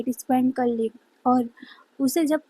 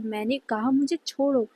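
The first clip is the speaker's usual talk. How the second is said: ordinary delivery, nothing marked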